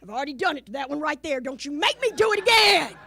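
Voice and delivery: imitated threat rising to a shout; fed-up mama. Mama threatening misbehaving kids, acted shout building at the end. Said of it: "she'd get fed up".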